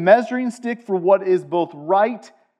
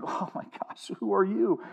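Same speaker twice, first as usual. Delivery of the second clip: whisper; 10 dB quieter than usual. Acted. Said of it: "like, God's like".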